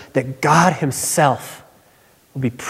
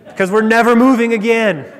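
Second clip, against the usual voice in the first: laughing punchline; 6 semitones higher, 3 dB louder than usual. Real, not acted. Punchline of moving story.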